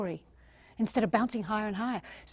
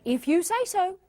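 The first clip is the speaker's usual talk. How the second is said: higher-pitched, lively comeback; bright-energy demo, pitched up; energetic, with conviction; 8 semitones higher than usual. Shown as a demonstration. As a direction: put life and conviction behind it. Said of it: "give them some energy".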